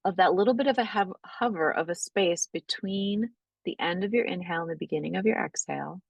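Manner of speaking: calm, unhurried breathwork guidance; guided meditation. Acted. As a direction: ease the class gently into noticing the breath. Leads a guided breathing practice.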